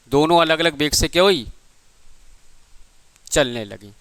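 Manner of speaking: usual speaking voice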